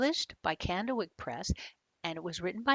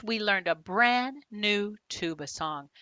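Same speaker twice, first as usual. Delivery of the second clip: ordinary delivery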